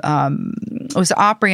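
ordinary talk, nothing marked

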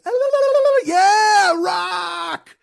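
high, loud mock rocker shout; jeering fake headbanger wail; mocking metalhead yell. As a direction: ham up a drunk metal fan screaming along. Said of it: "they'd be like".

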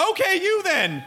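high-pitched, giving-in outburst; mock exasperation. Host picking an audience member for questions, mock exasperated. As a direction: throw it out high, half laughing.

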